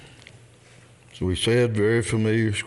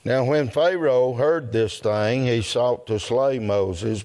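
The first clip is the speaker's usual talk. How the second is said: measured, deliberate Bible reading to a congregation; scripture reading; 3 semitones higher, slower than usual. Real, not acted. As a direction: read the verses out slowly and solemnly, phrase by phrase. Reads scripture aloud to congregation.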